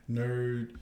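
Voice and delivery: ordinary talk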